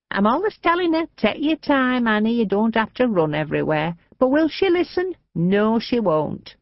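lightly voiced frail old lady, fond and fussing; a gossipy old Lancashire woman grumbling fondly about her sister; old woman chattering about her sister; chatty old lady. A narrator's reading.

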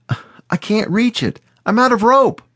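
put-on boy calling out. Boy stuck on the roof calling.

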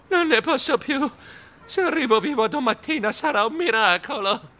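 high, overwrought gasping complaint; panting, shaken. A narrator's reading. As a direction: wail it as if barely surviving a scare. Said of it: "Io ansimai, pallidissimo". (voce acuta, ansimante e sconvolta)

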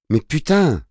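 high-pitched frustrated outburst; exasperated retort. A narrator's reading. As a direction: snap back in protest at a parent. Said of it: "rétorqua Victor". (riposte exaspérée, très aiguë)

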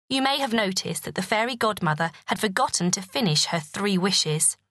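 usual speaking voice

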